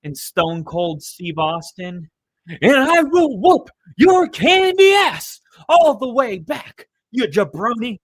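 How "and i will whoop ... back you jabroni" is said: high, loud, slow wrestling trash talk, hammed up; The Rock impression. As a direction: bellow the boast like a showman wrestler calling out a rival. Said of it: "with The Rock".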